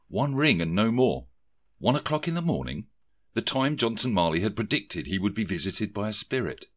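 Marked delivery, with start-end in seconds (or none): none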